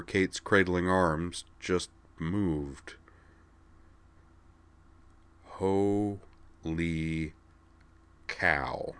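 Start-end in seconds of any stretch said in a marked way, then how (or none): 5.4-8.9 hushed, drawn-out awe; quiet amazement, words spaced apart; reverent. A narrator's reading. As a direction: breathe it slowly in softened wonder. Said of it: "said Corwin reverently".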